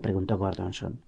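plain reading voice

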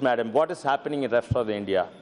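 ordinary talk, nothing marked

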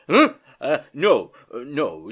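jolted awake, flustered stammer; loud start, then hesitant denial; startled, pulling himself together. A narrator's reading. Dozing uncle caught out by niece, flustered. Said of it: "pulling himself together".

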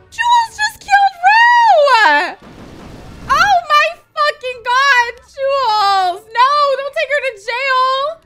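high, loud, drawn-out shriek; horrified screaming. Real, not acted. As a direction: scream in shock, stretching the words. Horrified by killing and arrest.

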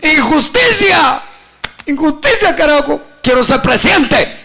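high-pitched mock rebel shouting, first word stretched; retold protest shout. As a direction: cry out like a hammed-up protester. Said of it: "le grité a la fiscal". (grito de protesta exagerado, en tono alto)

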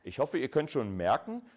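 normal talking voice

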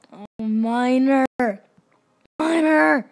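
drawn-out swell into a shout; building excitement. Real, not acted. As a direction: stretch it, building to a loud cheer.

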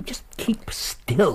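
slow, gentle whisper; tender whisper. Acted. Holmes tends wounded Watson in a slow tender whisper. Tending his wounded friend.

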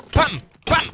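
high, loud "pam pam"; punchy mouth-made gunshots; imitated shot noise. Real, not acted. Voices each shot during play. (imitazione acuta di spari)

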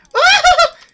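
high, loud yelp; panicked shriek. Real, not acted. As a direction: cry out as death closes in. Panicking as game character dies.

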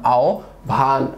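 আহ্বান drawn out as "ao... bhan"; standard pronunciation. Shown as a demonstration. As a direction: slowly split আহ্বান into "ao" and "bhan". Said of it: "প্রমিত উচ্চারণ কোনটি".

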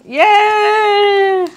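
high, drawn-out cheer. Real, not acted. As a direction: whoop with delight. Cheering win.

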